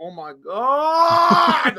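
high, loud, long-drawn wail of frustration; exasperated scream. Retold or imitated. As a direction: stretch it into an exasperated shout. Retold exasperation at wife's rant.

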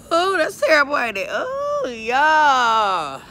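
high, loud exclamation; loud dismay. Real, not acted. Vlogger dismayed at being out of breath, loud exclaiming. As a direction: wail it out in dismay.

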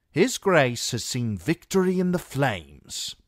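a boastful camp rumour-monger; Foxglove's voice. A narrator's reading.

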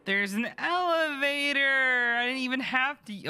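very high, slow thrilled squeal; excited gasp. Real, not acted. Finding an elevator at last, squealing high with joy.

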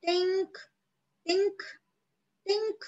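very high, soft tink tink; high tiny chirping tink; tiny bird voice. Acted. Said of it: "a tiny voice".

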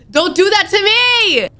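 high, slow pleading cry; real fear, begging; frightened plea. Real, not acted. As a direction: beg the game to stop, scared. Pleading after a scare.

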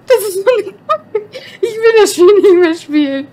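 loud, half laughing, half crying; panicked laughter near tears; laughing in fear. Real, not acted.

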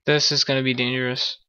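usual speaking voice

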